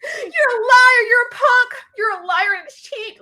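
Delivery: high, loud crying accusation; tearful yelling. Retold or imitated. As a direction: shout through tears at the liar. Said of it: "that's why it's like".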